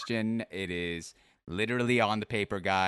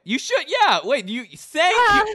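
excited; 13 dB louder than usual. Real, not acted. Loud excited intro to guest.

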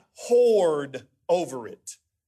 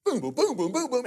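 quick-fire mock booms, pitched up; rapid-fire; 5 semitones higher than usual. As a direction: rattle off the booms at top speed. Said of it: "I mean, really fast".

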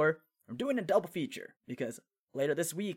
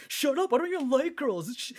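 much higher, louder, flustered denial; a kid brushing off teasing, hammed-up; imitating a flustered boy denying he likes girls; defensive boy; 10 semitones higher, 4 dB louder than usual. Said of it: "he's all like".

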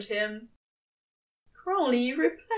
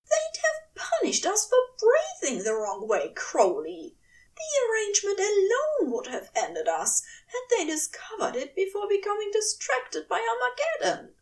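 higher, agitated angel pleading; upset angel voice; 10 semitones higher than usual. A narrator's reading. Aziraphale argues with Crowley, high and upset. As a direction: argue with rising distress. Angel's raised voice, angry argument.

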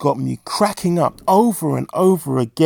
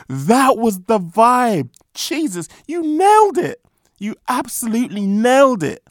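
high-pitched, emphatic admiration; delighted praise; 7 semitones higher than usual. Real, not acted. Host congratulating guest on a perfectly apt clip, delighted.

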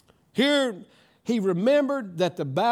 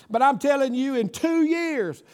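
higher, driving preacher delivery; full-force preaching; 6 semitones higher, 5 dB louder than usual. Real, not acted. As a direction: build the testimony to a ringing proclamation.

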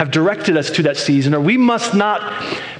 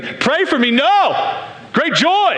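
higher, hammed-up cheer at bad news; mock overjoyed; 7 semitones higher, much slower than usual. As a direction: play it over-the-top thrilled. Mock overjoyed reply to sympathy.

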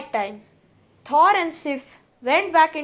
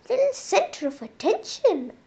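higher, sneering mock sweetness; sly trickster Loki; 5 semitones higher than usual. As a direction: taunt the sleeping goddess. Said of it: "he said".

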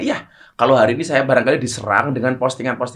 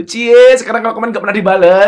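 louder, higher imitated jeer; mock teasing; 6 semitones higher, 6 dB louder than usual. Netizen ribbing him over unanswered comments, mock teasing. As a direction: mimic a commenter ribbing someone.

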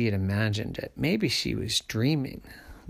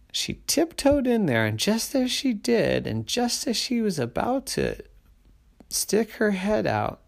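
higher, building storybook suspense; 9 semitones higher than usual. A narrator's reading. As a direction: tiptoe through the words, higher. Suspense while tiptoeing.

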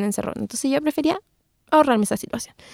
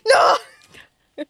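high wailing no; hammed-up sob; mock sobbing no; 10 semitones higher than usual. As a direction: cry it out. Sobbing refusal.

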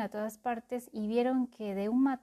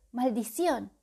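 grumbling; 2 semitones higher, 6 dB louder than usual. Acted. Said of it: "refunfuñó la voz".

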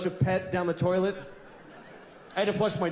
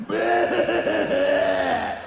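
loud, high, ugly bleating shout; hammed-up loud bleat; ugly sheep bleat; 12 semitones higher, 8 dB louder than usual. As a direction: bleat like a fat filthy sheep. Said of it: "they're like".

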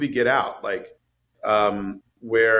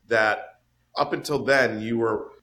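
normal talking voice

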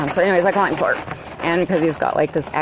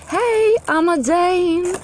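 high, drawn-out put-on Danish accent; mock Danish accent; 4 dB louder, much slower than usual. Shown as a demonstration. Showing how tongues shape accents, mock Danish accent. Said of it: "gives that special hint that".

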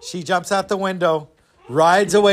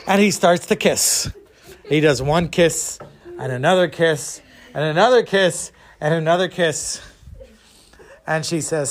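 plain reading voice

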